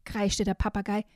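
put-on lively storybook reading for small kids; reading to children. Host reading a children's book excerpt to young listeners, storybook voice. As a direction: read it like a bedtime picture book.

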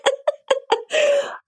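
high, breathy embarrassed laugh; a sudden high giggle at a cheeky question; burst of laughter. Real, not acted. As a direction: laugh out, caught off guard.

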